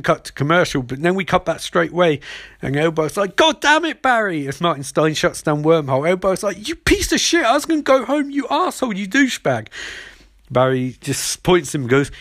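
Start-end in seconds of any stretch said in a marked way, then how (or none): 3.4-4.5 high-pitched hammed-up cursing; exasperated villain, voice shooting up; mock exasperated outburst. Acted villain cursing his rival. 6.7-9.7 high-pitched, whiny mock rage; hammed-up villain ranting, falling at the end; mock furious tirade. Acted villain insulting his rival.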